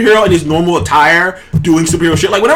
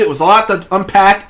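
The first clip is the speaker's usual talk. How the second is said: normal talking voice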